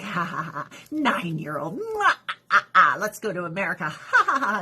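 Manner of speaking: leering cackle, mock villain; mocking old man. Mocking a groom of a child bride, gloating cackle. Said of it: "man was like".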